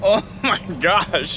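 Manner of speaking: breaking into laughter mid-phrase; laughing. Real, not acted. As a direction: gasp it out through laughs. Laughing at the truck starting.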